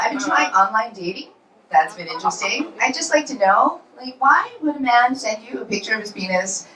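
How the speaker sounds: ordinary delivery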